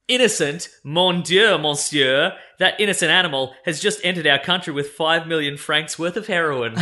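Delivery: 3 play a comic-book Frenchman, exclaiming; 1 French accent. Retold or imitated. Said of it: "speak in a French accent".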